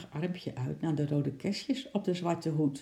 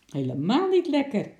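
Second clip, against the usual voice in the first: loud, higher, emphatic denial; indignant retort; 6 semitones higher, 6 dB louder than usual. A narrator's reading. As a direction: contradict the parrot loudly and indignantly. Indignant lady answers the parrot. (luid, verontwaardigd tegenspreken)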